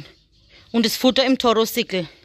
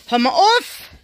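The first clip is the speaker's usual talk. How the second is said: higher, louder, scolding the rabbit; sharp call; 7 semitones higher, 5 dB louder, slower than usual. Real, not acted. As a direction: snap it at the rabbit. Ruft dem Hasen zu. (Frau schimpft laut mit dem Hasen)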